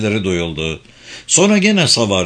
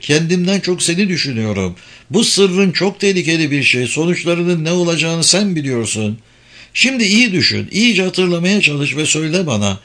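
higher-pitched, earnest appeal to husband; concerned wife; 7 semitones higher than usual. A narrator's reading. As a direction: urge him to think carefully, pitch raised. (kocasını uyaran kaygılı eş)

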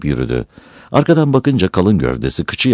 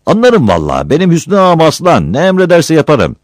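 eager flattery; 12 semitones higher, 5 dB louder than usual. Acted. Accused man flatters his master.

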